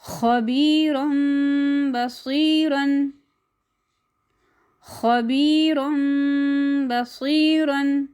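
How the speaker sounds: खबीरन बसीरन with nasal म before ब, drawn out; slow recitation. Shown as a demonstration. Tajweed examples. (धीमी तजवीद क़िराअत)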